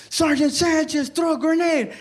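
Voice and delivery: high-pitched mock battlefield call. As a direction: call the order across a firefight. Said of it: "somebody calling me".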